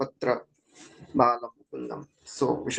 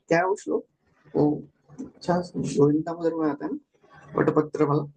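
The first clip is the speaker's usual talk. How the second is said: ordinary talk, nothing marked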